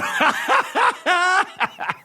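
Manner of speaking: high laughing fit breaking off the song. Real, not acted. [name], too drunk to keep singing, bursts into high laughter. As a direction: crack up mid song.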